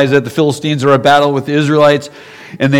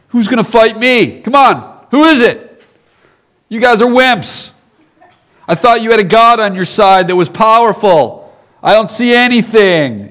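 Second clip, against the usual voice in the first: taunting giant; 8 semitones higher than usual. Acted. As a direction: jeer at the cowards across the field. Goliath taunting the army.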